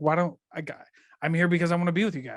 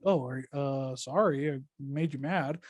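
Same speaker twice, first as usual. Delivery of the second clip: low, soft, hesitant mock apology; sheepish apology; 3 semitones lower, 5 dB quieter, slower than usual. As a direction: back off awkwardly, imitating a sorry caller. Acts out flustered caller backing off.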